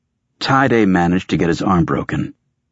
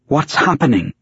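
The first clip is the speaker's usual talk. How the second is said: high-pitched demand as a woman character; insistent, higher-pitched question; 8 semitones higher than usual. A narrator's reading. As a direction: demand an answer in a raised voice. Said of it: "she demanded".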